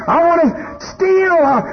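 body urging you to steal and lie; the sinful body. Acted. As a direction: tempt like a sly craving body. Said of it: "and it's going".

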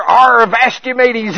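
a hint of a hammed-up pirate; lightly voiced pirate captain. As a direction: growl it like a cartoon pirate. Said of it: "Peter's over there".

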